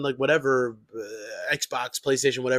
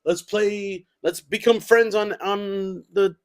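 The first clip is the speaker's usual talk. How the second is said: a touch higher, eager gamer talk; geeky gamer voice; 6 semitones higher, 4 dB louder, slower than usual. Retold or imitated. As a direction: play it like a nerdy online buddy. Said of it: "I sound like such a fucking".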